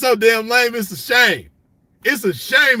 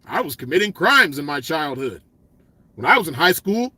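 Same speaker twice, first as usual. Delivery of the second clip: mocking a lame poser; 5 semitones lower than usual. Mocking a fake gangster's confession, a low prim voice. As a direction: say it like a square pretending he was bad. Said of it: "you ain't gonna never hear me say".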